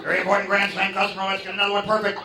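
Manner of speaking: high, precious perfectionist voice; high, dainty craftsman voice; fussy cook. Retold or imitated.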